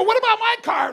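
high, loud laughing plea; stranded guy shouting after his friend; desperate shout. Retold or imitated. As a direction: yell after the car. Calling after departing car.

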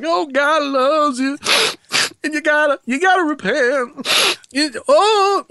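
high, slow, hammed-up weepy revival preacher; high mock sobbing preacher voice; mock crying preacher. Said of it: "they call 'em crime preachers".

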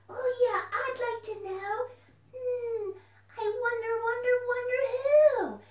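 high, loud, excited puppet voice; a bouncy little puppet popping up; puppet fox voice. Acted. Puppet fox greets the children, high and loud.

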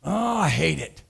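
mock the reluctant car shopper; whiny car buyer. Pastor mocking churchgoers dreading car dealerships, whiny. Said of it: "they'll say".

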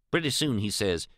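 plain reading voice

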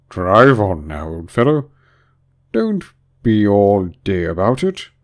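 loud, drawn-out creature voice; impatient Griffin. A narrator's reading. Griffin hurrying the Mock Turtle, loud and impatient. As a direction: urge him on with big, slow words.

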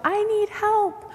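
high, slow call for help. Shown as a demonstration. Leader showing children how to ask for help, high call. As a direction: draw it out so others hear. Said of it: "might have to call out".

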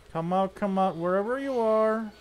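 high sing-song taunt. Pretend. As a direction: lure them out playfully. Taunting hiding enemies.

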